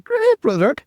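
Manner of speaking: very high, cheery brush-off; chirpy mock reply. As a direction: sing it up high, falsely bright.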